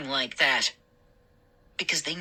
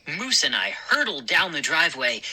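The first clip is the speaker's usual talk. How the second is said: ordinary delivery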